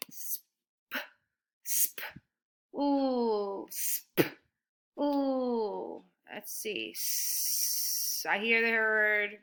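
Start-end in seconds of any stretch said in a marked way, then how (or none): 0.1-6.0 sp whispered, ooh stretched soft; sounding out. Shown as a demonstration. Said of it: "figure out the first part".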